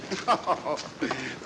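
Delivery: short knowing chuckle; a light, superior little laugh; amused chuckle. Acted. Holmes amused by Scotland Yard question.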